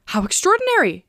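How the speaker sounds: high-pitched burst of surprise; amazed exclamation. A narrator's reading. Candide recognizes the colonel, amazed exclamation. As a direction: exclaim in sheer wonder. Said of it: "exclaimed Candide".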